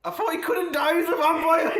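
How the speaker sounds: high, loud outburst through real laughter; laughing protest. Real, not acted. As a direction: exclaim it while cracking up. Helpless laughter at the story.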